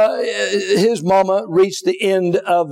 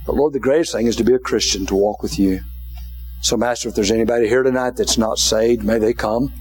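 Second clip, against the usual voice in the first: prayer; 4 semitones lower than usual. Acted. Preacher praying over the congregation, low gentle prayer. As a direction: pray gently over the room. Closing prayer and altar call.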